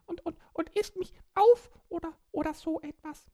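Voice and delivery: high squeaky little creature voice; small child voice. Acted. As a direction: speak like a tiny excited child. Borki alone on the island.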